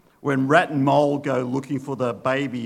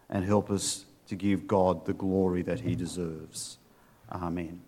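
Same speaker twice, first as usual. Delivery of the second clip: prayer; 3 semitones lower than usual. Acted. Pastor praying for the congregation, hushed and sincere. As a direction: pray earnestly and humbly.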